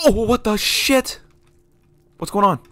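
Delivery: high, drawn-out startled outburst. Real, not acted. Player startled mid-game, high startled cry. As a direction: burst out high in shock.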